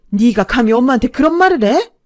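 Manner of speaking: loud, high maternal outburst; furious sudden yell. A narrator's reading. Mother outraged that her son answers back, yelling furiously. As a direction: explode at the son for talking back. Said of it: "남친 어머니가 버럭 소리를 지르자".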